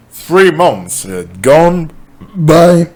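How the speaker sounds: drawn-out opening rising high and loud; overblown chapter opening. Acted. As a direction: stretch it out and push the pitch up to the end. Botched chapter opening, then coughs and retakes.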